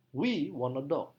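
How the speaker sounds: slow, We strongly stressed; teacher demo with We stressed. Shown as a demonstration. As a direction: press hard on We. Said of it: "Penekanan paling kuat pada kata we".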